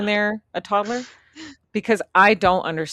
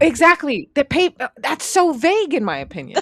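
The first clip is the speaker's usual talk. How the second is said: loud, high-pitched objection; playful exasperation; 9 semitones higher, 6 dB louder than usual. Real, not acted. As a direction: protest in jest, voice way up. Joking argument over word pavement.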